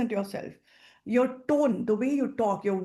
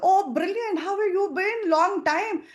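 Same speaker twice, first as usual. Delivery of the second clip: higher and louder friend reply; excited friend; 8 semitones higher, 4 dB louder, slower than usual. Shown as a demonstration. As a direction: sound genuinely thrilled to hear from her. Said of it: "Shashi says".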